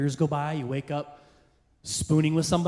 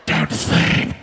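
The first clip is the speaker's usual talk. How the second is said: horror movie villain; 2 semitones lower, 6 dB louder than usual. Retold or imitated. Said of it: "She sounds like a villain in a horror movie".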